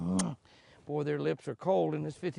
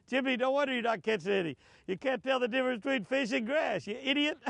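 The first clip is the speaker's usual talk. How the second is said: mock scolding; 9 semitones higher than usual. Mock-scolding himself by name.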